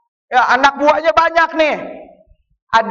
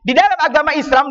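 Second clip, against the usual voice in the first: ordinary delivery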